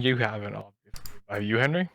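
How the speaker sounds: normal talking voice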